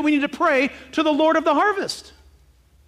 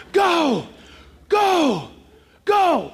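shouted go go go; 4 semitones higher, much slower than usual. Retold or imitated. Sergeant's job, throwing out.

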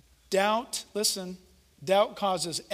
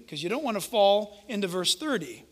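usual speaking voice

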